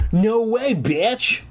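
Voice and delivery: high-pitched defiant cry. A narrator's reading. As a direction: call it out high in hammed-up defiance. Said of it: "I cried".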